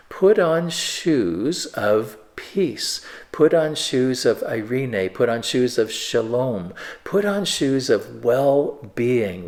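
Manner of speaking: drawn-out preacher's call, leaning on put each time; slow emphatic exhortation. Acted. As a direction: proclaim it slowly like Paul urging the church. Preacher voicing Paul's exhortation.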